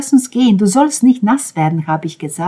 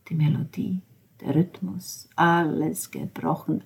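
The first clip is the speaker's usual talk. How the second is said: whisper; 10 dB quieter than usual. Acted.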